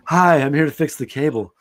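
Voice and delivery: lightly voiced cable repairman; loud, cheesy porn-film greeting; porn cable guy. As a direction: announce yourself like a hammy adult-film handyman. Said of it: "There's several variations".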